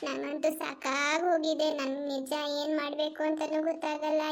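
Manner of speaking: ordinary talk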